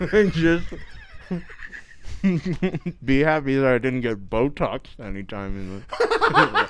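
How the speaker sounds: laughing through a full mouth; muffled words round marshmallows; cheeks stuffed full. Real, not acted.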